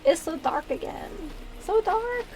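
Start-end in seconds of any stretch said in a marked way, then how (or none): 1.6-2.2 put-on high, quavery little old lady. Shown as a demonstration. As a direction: squeak it like a tiny grandma. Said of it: "Me and my little old lady voice".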